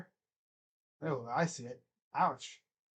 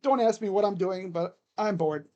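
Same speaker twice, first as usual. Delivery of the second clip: ordinary talk, nothing marked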